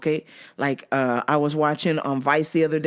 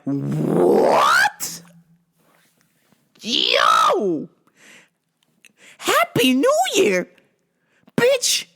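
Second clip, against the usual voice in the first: outraged disbelief; much slower than usual. Retold or imitated. Worker hearing Happy New Year in June, outraged squawk. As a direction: blow up, incredulous and strained.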